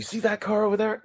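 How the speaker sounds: neighbor pointing. Retold or imitated. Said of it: "And he says".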